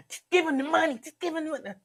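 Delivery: very high-pitched comic impression; Lincoln Ware. God pressing for money, high Lincoln Ware voice. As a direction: keep it way up high, insistent. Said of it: "what if God sound like Lincoln Ware".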